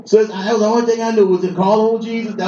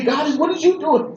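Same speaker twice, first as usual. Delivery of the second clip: pleading to God; 9 semitones higher than usual. Retold or imitated. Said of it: "I talk to God just like this".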